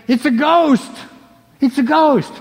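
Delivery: 3 play the fishermen yelling about the ghost; 4 hammed-up frightened cry; 1 mock scream of fear. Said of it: "They're screaming for fear".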